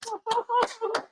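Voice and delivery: giddy high-pitched giggle; high squealing laugh. Real, not acted. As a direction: let out a squeal of glee. Celebrating election results live.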